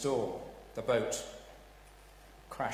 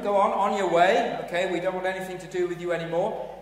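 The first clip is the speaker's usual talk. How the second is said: dismissive brush-off; 5 semitones higher, 9 dB louder, faster than usual. Acted. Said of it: "were glad to get rid of them".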